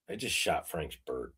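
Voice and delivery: very soft, low retold dismay; sinking realization. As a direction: drop to a hushed confession to yourself.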